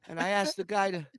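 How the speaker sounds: high, fast hurried explaining; flustered explaining. Retold or imitated. Traveler rushing his excuse to the agent, high flustered. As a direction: rush the excuse out. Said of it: "And I go".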